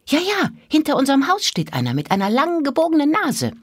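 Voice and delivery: put-on high, fast chattering girl; eager little girl. A narrator's reading. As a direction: rattle it off like an excited child. Mira's voice in story.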